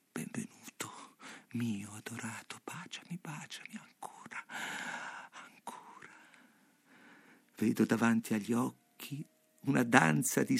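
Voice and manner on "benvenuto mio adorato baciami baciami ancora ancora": whisper. A narrator's reading. Beloved woman welcoming the man kneeling before her, whispered. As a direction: whisper it intimately, almost fading away. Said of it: "il suo alito odoroso che mi bisbiglia".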